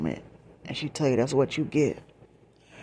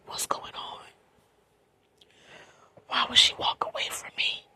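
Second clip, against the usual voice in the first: choked, voiceless whisper; hushed, bewildered tearful plea. Retold or imitated. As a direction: whisper it as if breaking down, barely any voice. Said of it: "I broke down crying".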